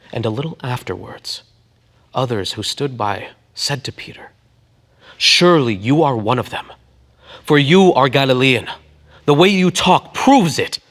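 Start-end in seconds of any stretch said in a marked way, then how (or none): 5.2-10.8 louder, higher accusing voice. Acted. Bystanders accusing Peter, loud and higher. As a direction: corner him with the accusation.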